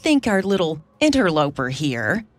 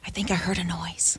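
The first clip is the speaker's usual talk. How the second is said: hushed, breathy whisper, much softer; 7 dB quieter than usual. Acted. Wife whispers to David, fearing someone overheard them. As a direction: whisper so no one outside hears. Frightened, fears being overheard.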